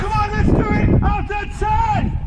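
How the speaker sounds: high, loud countdown call; crowd countdown shout. Real, not acted. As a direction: count them in at full voice.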